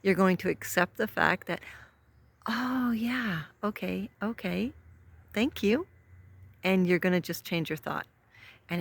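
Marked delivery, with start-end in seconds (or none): 2.5-5.9 lightly voiced, higher, resigned okay; a hint of sing-song surrender; mock gracious acceptance. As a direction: play out giving in with a thank-you. Acting out accepting a complaint.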